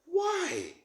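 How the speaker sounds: sharp high why in disbelief; a baffled high question; incredulous why. Real, not acted. Incredulous outburst.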